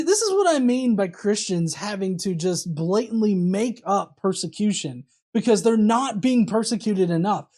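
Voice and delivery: usual speaking voice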